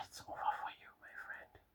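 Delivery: sigh, then whisper it gravely; whispered verdict, as if all hope is gone; hushed doom. Acted. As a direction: breathe it out in a near-silent whisper. Mock doom for a caught impostor.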